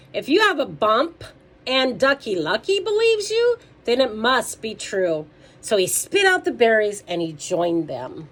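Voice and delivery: loud, lifted storytime voice; lively read-aloud for kids; animated children's story reading. A narrator's reading. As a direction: read it out big for little listeners.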